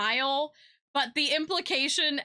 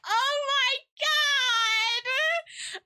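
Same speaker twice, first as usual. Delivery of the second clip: delighted squeal; 13 semitones higher than usual. Retold or imitated. Vendor squealing at the customer's sweet news. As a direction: squeal it, overjoyed. Reacting to birthday present.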